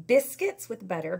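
plain reading voice

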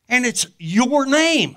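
high, loud and slow, building to the reveal; big slow triumphant announcement; dramatic reveal. Real, not acted. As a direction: proclaim it like a revelation.

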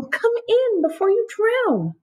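high, slow, kindly snail. Acted. Snail calls the ants in from the rain, high and slow. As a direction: invite them in slowly in a high little voice.

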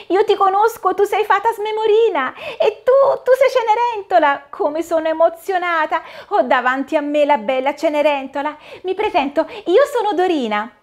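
put-on high child's voice, thrilled and a bit breathless; a starstruck girl meeting her heroine, pitched high; excited little girl. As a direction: Dorina introduces herself to Cinderella, high and thrilled. Little girl Dorina meets Cinderella.

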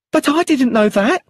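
high, loud protest of innocence; frightened exclamation. Acted. Widow protesting to the inspector, high and loud panic. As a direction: cry it out in panic. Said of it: "exclaimed Brenda".

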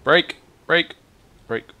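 short high, loud shouts; urgent call. Real, not acted. Gamer yelling to brake while driving, urgent call.

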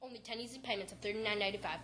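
quiet, rapid fine print; fast disclaimer. Acted. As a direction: rattle off the legal small print. Fast legal disclaimer read.

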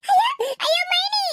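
high wailing call for help; anguished cry. Acted. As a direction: cry out in pain for Maini. Girl being beaten cries out. (அடி வாங்கி அலறும் குரல்)

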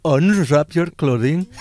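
slow Indian-accented seduction; sexy Indian accent. Shown as a demonstration. Performing dirty talk for friends, slow Indian accent. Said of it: "sexy talk in an Indian accent".

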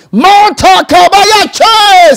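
slow, high, loud proclamation; preacher proclaiming loudly; bold shout. Real, not acted. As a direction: stretch it out and shout it. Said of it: "bold declaration".

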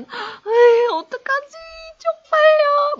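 high, hammed-up embarrassed squeal; mortified wail. Teacher acting out remembering last night's drunk behaviour, mortified. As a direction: squeal in mock mortification, drawn out. Acts morning-after embarrassment.

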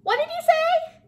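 very high, loud cricket voice; high-pitched, shouted question; oblivious cricket. A narrator's reading. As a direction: squeal it loudly like a little cricket. Voicing the cricket.

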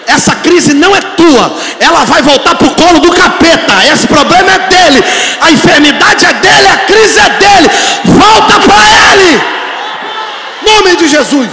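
loud, high rebuke, slowing on the last words; preaching at full force. Acted. Pastor leading the church to rebuke the crisis, shouting. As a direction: cast the crisis back to the devil, shouting.

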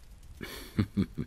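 breathless unvoiced little laugh; giddy laugh. Real, not acted. Alone after she says yes, giddy laugh. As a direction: laugh to himself, can't hold it in.

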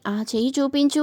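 ordinary delivery

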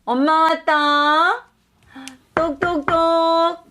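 high, slow teasing announcement; singsong announcement. Acted. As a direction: sing out your arrival.

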